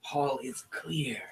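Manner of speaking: a sly shaman sharing a secret grudge; goblin shaman whisper. Acted. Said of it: "And she says".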